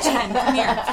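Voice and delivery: repeats Jen, come here loudly for listeners who missed the whisper. Shown as a demonstration. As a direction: says Jen, come here out loud this time. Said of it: "in case you couldn't hear that".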